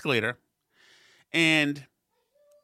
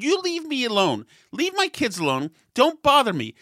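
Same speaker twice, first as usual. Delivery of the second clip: higher, fed-up snap; angry brush-off; 8 semitones higher than usual. Retold or imitated. Father driving off the meddling woman, irritated higher voice. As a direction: push her away with words. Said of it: "I said".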